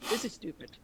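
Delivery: normal talking voice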